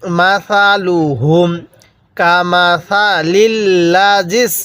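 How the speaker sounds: slow, drawn-out tajweed chanting; teacher reciting slowly for students to copy; Quran recitation. Shown as a demonstration. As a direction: recite each syllable long and even. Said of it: "তাহলে কিভাবে পড়ব?".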